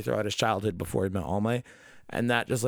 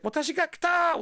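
put-on much higher, proud little-kid declaration; kid Deku quoting All Might. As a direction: announce it like a tiny hero. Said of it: "And he's like".

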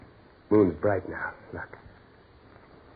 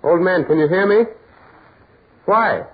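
calling out; 5 semitones higher, 6 dB louder than usual. Acted. Carl calls to the old man again.

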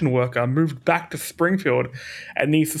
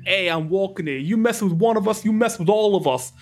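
put-on higher, tough New York street accent; 4 semitones higher than usual. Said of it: "we probably spoke in that voice".

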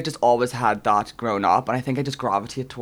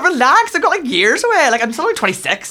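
much higher and louder, laughing through it; mock exasperated reply; 13 semitones higher, 7 dB louder than usual. Telling mum to relax about turning thirty, mock-exasperated and squealing. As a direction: squeal it like a hammed-up protest.

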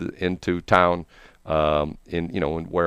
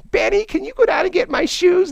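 high falsetto plea; 4 dB louder than usual. Retold or imitated. Drunk secretary asking Benny for her shoes, high falsetto. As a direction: beg for the shoes in a squeaky lady voice.

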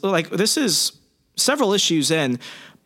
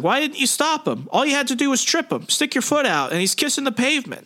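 exasperated bystander; 10 semitones higher than usual. Retold or imitated. Guy scolds Peter for not stopping thief.

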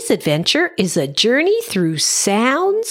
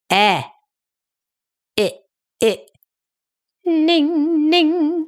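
vocal warm-up sounds. Shown as a demonstration. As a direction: sing each syllable slowly twice. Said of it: "Sounds like".

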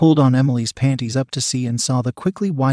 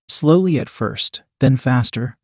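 ordinary delivery, nothing marked

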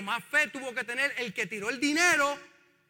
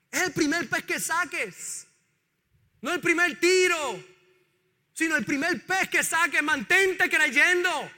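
higher, slow, emphatic preaching; 5 semitones higher, 5 dB louder, slower than usual. Real, not acted. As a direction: lean hard on every word. Preacher exhorts at full force. (predicando con fuerza y lentitud)

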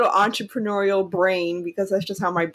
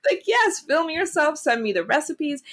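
high, delighted, gushing yes; thrilled; 11 semitones higher than usual. Retold or imitated. Daughter telling mom to film her cooking, much higher and thrilled. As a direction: leap at the offer with joy.

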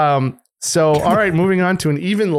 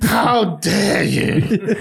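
mock Greta Thunberg; 5 semitones higher, much slower than usual. Repeating Greta line mockingly.

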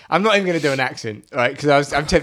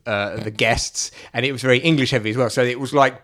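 ordinary talk

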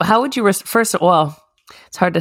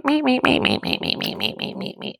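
meeps fading to whisper; 9 semitones higher, 6 dB quieter than usual. Retold or imitated. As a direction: beep it out, fading away. Mind constantly chattering.

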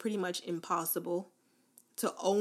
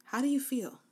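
ordinary talk, nothing marked

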